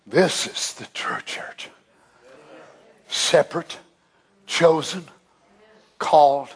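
low voiceless whisper; hushed. Real, not acted. Preacher confiding to the congregation, whispered low. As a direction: lean in and whisper it. Hushed aside about the church.

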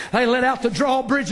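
usual speaking voice

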